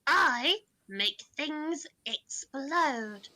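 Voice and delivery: very slow, deliberate, muffled boast; deadpan machine listing its duties; masked robot voice. Acted. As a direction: speak it slowly from behind a mask. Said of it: "he's gonna say from under the mask".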